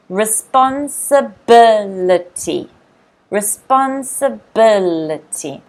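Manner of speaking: Responsibility slowed, with the fourth syllable "bil" leaned on; teacher modelling Responsibility with stress on "bil". Shown as a demonstration. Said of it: "Notice the stress is on the ... fourth syllable".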